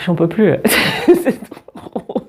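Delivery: helpless breathless laughter; real laugh swallowing the words; real giggling fit. Real, not acted. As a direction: break up laughing mid-reading. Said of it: "J'ai un gros fou rire".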